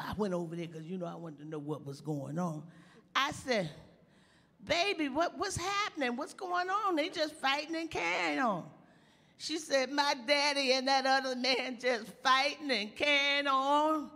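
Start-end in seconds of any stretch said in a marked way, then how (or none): 4.6-7.0 put-on much higher, gentle asking of a child; concerned asking. Teller asking the crying girl, voice lifted much higher. 7.0-14.1 put-on a higher, childlike voice; little girl. Little girl answering the teller in a high childlike voice.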